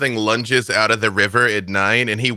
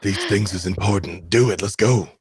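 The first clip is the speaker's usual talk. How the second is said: lower-pitched, lightly voiced character urging the others on; 3 semitones lower than usual. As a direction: push them along in a low character voice. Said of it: "I'm all like".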